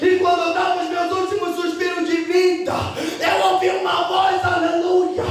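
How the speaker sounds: preacher booming the call to open the Bible; preaching at full force. Real, not acted. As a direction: proclaim it. Preaching climax, heavenly voice.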